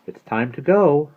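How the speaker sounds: high, loud, slow call; announcing departure. A narrator's reading. Maggie tells the girls of leaving, high drawn-out call.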